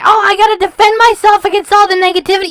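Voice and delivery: high, fast mocking whine; mocking whiny voice. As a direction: mimic her defending herself, whiny and quick. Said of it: "you say".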